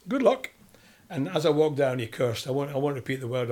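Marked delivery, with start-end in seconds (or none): 0.1-0.5 much higher, louder mock good luck; Clough's good luck. Brian Clough wishing the young debutant luck, mock high chirp. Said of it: "He said".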